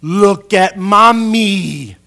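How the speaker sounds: very loud, drawn-out, high-pitched mom; imitated mother's exaggerated, rising-volume demand; mom insisting loudly. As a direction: stretch it out slowly to force a child's attention. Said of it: "She would go".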